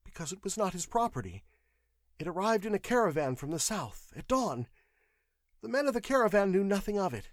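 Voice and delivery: high, anxious clerk's voice; frightened witness under questioning; nervous clerk. A narrator's reading. Clerk's voice in narrated story.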